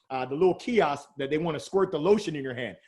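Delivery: usual speaking voice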